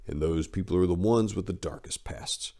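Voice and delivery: ordinary delivery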